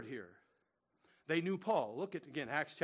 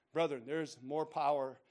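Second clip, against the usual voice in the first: ordinary talk, nothing marked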